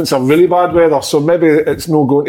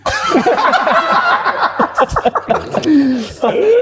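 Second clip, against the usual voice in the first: long high laugh. Real, not acted. Long laugh after breastfeeding joke.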